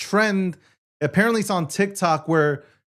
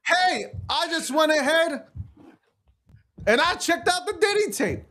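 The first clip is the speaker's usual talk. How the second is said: high-pitched put-on voice; mock viewer; 10 semitones higher, 3 dB quieter than usual. Host posing as a viewer confessing to a detour, mock viewer.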